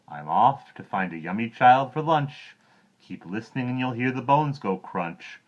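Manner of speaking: gloating croc. Acted.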